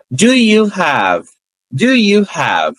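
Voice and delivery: very slow, each word apart; slow and clear. Shown as a demonstration. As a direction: spell it out slowly for learners. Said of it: "eu não falo pausadamente". (bem devagar, palavra por palavra)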